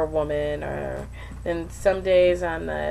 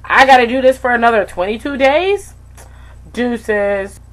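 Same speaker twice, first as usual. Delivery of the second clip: loud, higher fed-up rant; exasperated inner voice; 6 semitones higher, 12 dB louder than usual. Retold or imitated. Retelling the moment she wanted to quit, loud and fed up. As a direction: throw up your hands and bail. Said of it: "I was like".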